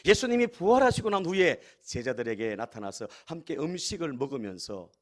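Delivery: usual speaking voice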